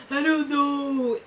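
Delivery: slow, drawn-out imitated wailing; mock crying. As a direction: whine it through pretend sobs. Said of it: "And I'm just crying".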